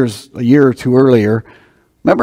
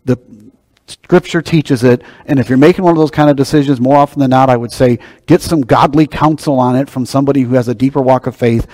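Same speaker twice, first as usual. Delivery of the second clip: usual speaking voice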